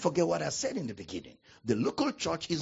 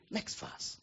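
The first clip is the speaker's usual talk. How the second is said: whispered aside; 10 dB quieter than usual. Real, not acted. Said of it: "aside to reader, whispered".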